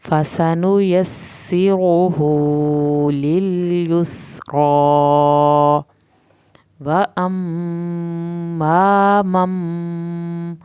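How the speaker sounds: slow, drawn-out tajweed chant; Quranic recitation. Shown as a demonstration. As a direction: recite each word slowly, melodically. Recites verse.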